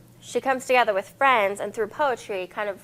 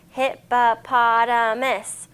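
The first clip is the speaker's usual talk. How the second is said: hippopotamus slowed into five beats hip-po-pot-a-mus; syllables clapped out; slower than usual. Shown as a demonstration. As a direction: clap each syllable of hippopotamus slowly.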